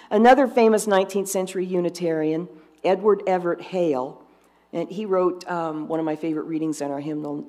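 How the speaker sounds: ordinary talk, nothing marked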